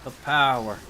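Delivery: put-on a failed Ulfric Stormcloak Nord accent, slowed right down; clumsy Skyrim Nord accent. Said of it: "I can't do that accent properly".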